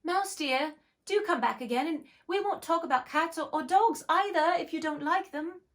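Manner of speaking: calling softly. Acted. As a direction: call coaxingly across the water. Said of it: "she called softly after it".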